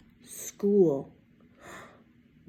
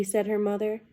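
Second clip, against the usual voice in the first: ordinary delivery, nothing marked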